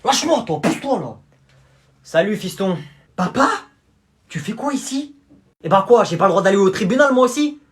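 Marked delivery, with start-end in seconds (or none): 0.0-1.2 loud, higher brusque order; gruff push. Acted. Dad shoving the toll guy aside, loud and rough. 5.6-7.6 loud, fast indignant retort; loud defiant dad. Acted. Dad talking back to his son in court, loud and fast.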